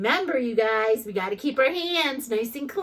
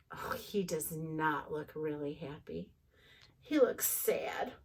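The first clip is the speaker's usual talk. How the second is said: much softer, nearly whispered; hushed sympathy; 8 semitones lower, 11 dB quieter than usual. A narrator's reading. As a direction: feel sorry for him quietly. Sympathetic narration.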